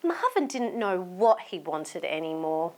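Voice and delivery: ordinary delivery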